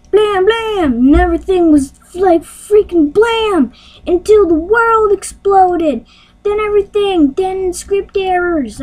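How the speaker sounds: high, hammed-up excited storytelling; frantic mock climax. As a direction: build the silly explosion tale to a high-pitched frenzy. Frantic climax of silly explosion story.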